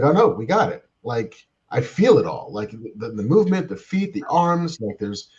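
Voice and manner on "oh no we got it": excited. Retold or imitated. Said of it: "it was like".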